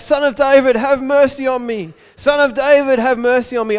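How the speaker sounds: high, loud plea; yelling out. Retold or imitated. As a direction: call out desperately for mercy. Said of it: "he starts yelling out".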